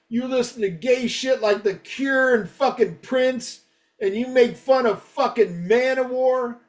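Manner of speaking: high, indignant mock-fan rant; retold angry threat. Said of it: "I got a lot of threats".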